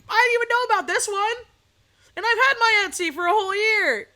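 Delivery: high, loud disbelieving cry; shocked outburst. Retold or imitated. Seller reacting to surprise boycott news, high loud outburst. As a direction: shout it in stunned disbelief.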